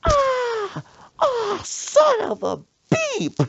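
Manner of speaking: high, loud shriek of pain; hammed-up stung scream, very high and loud; pained yelp. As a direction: squeal like a bee just stung you. Friend stung by bee.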